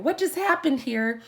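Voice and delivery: high, loud mock bewilderment; retold freak-out. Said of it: "freaking out and laughing and just going".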